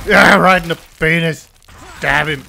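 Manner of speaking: loud gleeful yell at the screen; excited cheer. Real, not acted. Gamer cheering a gory kill, loud and gleeful.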